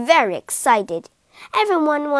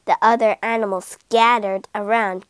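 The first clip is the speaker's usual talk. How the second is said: ordinary delivery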